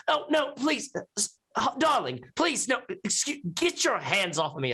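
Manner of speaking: high, panicked pleading; frantic woman. Acted. Woman fighting off a man inside, high and frantic. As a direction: beg off the grabbing hands. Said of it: "you hear a woman saying".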